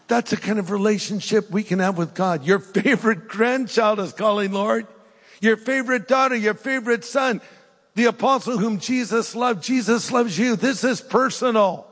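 preaching high and fervent; fervent preacher building to a climax; impassioned preaching. Acted. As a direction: lift it into a rousing appeal. Climax of the sermon appeal.

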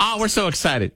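very high, thrilled caller squeal; excited caller. Acted. Caller telling radio hosts about a vacation, very excited. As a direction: gush it, high and giddy with excitement. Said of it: "he's very excited".

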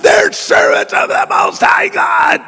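high screechy mock shriek, far above normal pitch; hammed-up possessed screaming. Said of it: "She's behind him screaming out".

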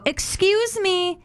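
mock a prim churchgoer; prim excuse me. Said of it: "she'll go".

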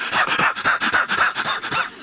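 rapid voiceless huffing; frenzied prophets' panting. Acted. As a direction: pant wildly like a crazed prophet. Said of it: "they're all like".

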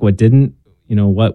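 usual speaking voice

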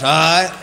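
"all right" as one word, rising at the end; Texan slurred word. Shown as a demonstration. Comedian showing a Texas word, slurred together. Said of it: "That's a word in Texas".

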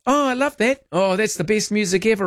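high-pitched imitation of a thrilled fan; mock gushing. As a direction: play a listener who adores it. Acts out a delighted listener.

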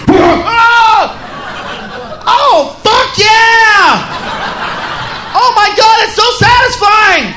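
high, loud, drawn-out ecstatic shout; mock orgasm scream after the dog barks; ecstatic cry. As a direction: lose it completely in delight. Acted surprise orgasm.

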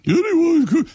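high, fluting celebrity-cook voice; Julia Child impression. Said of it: "That's the way she talked".

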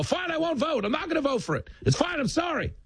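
high mock-exasperated surrender; high, pleading imitation; average person giving in. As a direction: cave in, hammed-up and flustered. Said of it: "They go".

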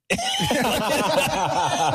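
a light, high little laugh; high giggle. Retold or imitated. [name]'s laugh imitated.

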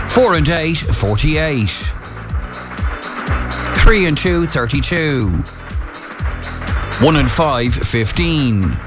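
calling each number out, then repeating it; measured bingo call with pauses; bingo caller. Acted.

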